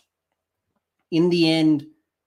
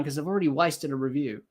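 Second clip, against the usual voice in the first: usual speaking voice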